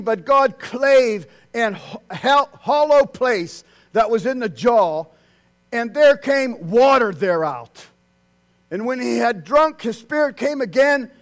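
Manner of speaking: reading scripture. Real, not acted.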